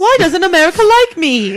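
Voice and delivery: high, loud whiny king voice; King George impression. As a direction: wail it like a sulky old prospector. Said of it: "That's my impression of King George the Third".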